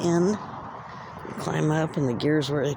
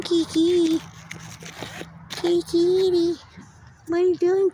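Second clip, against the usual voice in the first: high, sing-song pet talk. Real, not acted. Finding a stray cat by the train, cooing high baby talk. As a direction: coo up high at the cat to coax it closer.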